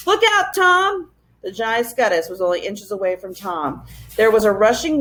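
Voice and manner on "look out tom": much higher, louder slow scream; fearful scream. A narrator's reading. Ben screaming to warn Tom, terrified. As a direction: scream a warning in terror. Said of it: "screamed with fear".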